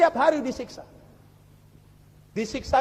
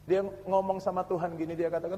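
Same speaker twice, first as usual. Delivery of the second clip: ordinary talk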